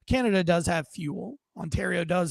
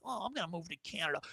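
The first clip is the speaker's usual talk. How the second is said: mock pouting threat; 2 semitones lower, 13 dB quieter, faster than usual. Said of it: "Americans wanna be Canadian".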